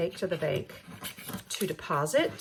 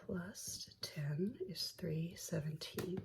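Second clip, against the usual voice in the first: whisper; 14 dB quieter, slower than usual. Real, not acted. Whispered recount to herself.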